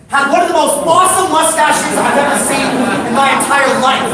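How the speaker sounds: high, loud, overwhelmed admiration; gushing awe. Real, not acted. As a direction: rave about the mustache. Gushing over mustache.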